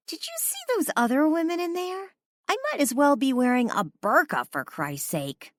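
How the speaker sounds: high, exasperated complaint; indignant whine. A narrator's reading. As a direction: protest like she's overdressed. Drunk woman complaining about outfit.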